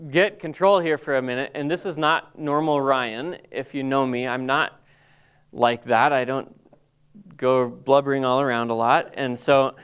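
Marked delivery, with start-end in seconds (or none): none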